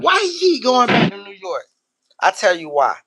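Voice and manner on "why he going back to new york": higher mock questioning voice; imitated objector. Imitating a listener who questions the New York topic, high mock complaint. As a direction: mimic a complaining listener, pitched up. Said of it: "'cause my motherfucker said".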